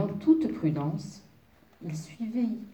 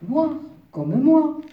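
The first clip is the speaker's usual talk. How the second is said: loud, slow, emphatic wolf answer; with conviction; 8 semitones higher, 10 dB louder, much slower than usual. A narrator's reading. Wolf tells the fairy the safe colour, with conviction. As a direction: declare it firmly, high and loud. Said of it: "répliqua le grand loup avec conviction".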